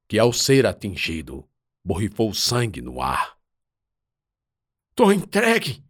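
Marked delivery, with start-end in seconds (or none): 4.9-5.8 high, loud cry of surrender; desperate shout. Acted. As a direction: yell it out, giving up. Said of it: "Um gritou do interior".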